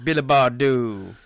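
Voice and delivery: loud, slow mock superhero voice; Batman. Parodying a film hero's accent for co-host, mock voice. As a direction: drag it out loudly as a spoof hero. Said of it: "do you remember Batman's accent?".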